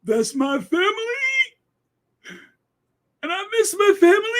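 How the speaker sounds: high, rushed mock wail, shouted at the end; mock tearful plea. Mocking what Harry could have said on TV, high mock wail. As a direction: blurt it like a hammed-up TV sob. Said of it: "gone on the television and said".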